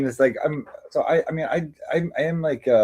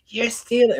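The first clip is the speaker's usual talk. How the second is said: mock shrill accusation; 13 semitones higher, slower than usual. Retelling the store owner accusing him of theft, high mock yell. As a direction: squeal it like the owner catching a thief. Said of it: "she's, like, screaming".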